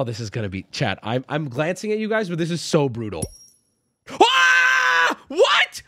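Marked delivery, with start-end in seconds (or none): 4.1-5.8 high shrieked "Ah! What?", much louder; shocked shout. Real, not acted. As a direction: yelp out in real shock. Shocked at a game result.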